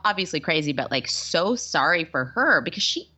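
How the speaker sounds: ordinary delivery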